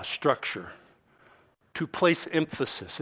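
ordinary delivery

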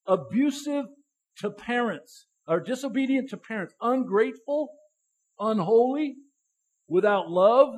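a preacher hammering the list word by word; full-force preaching. Acted. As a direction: drive each vice home from the pulpit.

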